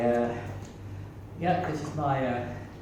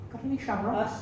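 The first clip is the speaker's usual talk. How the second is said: leprechaun; 5 semitones higher, 5 dB quieter than usual. Acted. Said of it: "she's playing the part of a leprechaun".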